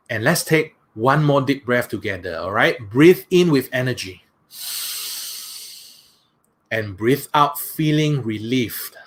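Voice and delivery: soothing guided relaxation for a live audience; guided meditation. Acted. Leads a guided breathing meditation.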